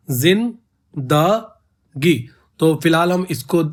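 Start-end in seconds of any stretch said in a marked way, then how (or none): 0.1-2.3 जिंदगी drawn out very slowly with a fall; stretched word. Shown as a demonstration. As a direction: let जिंदगी stretch long, syllable by syllable. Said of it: "इसी तरीके से जिंदगी". (जिंदगी को बहुत धीरे खींचकर)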